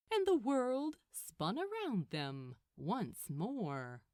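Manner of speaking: ordinary delivery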